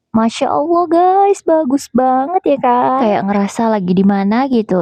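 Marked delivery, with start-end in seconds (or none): 0.1-3.0 high, slow, dramatic awe; over-the-top gushing. Pretend. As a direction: gush as if amazed. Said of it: "udah deh lebaynya".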